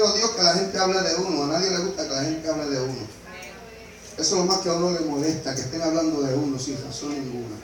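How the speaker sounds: soft heartfelt pulpit confession; quiet intimate preaching. Real, not acted. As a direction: draw the congregation in close, nearly hushed.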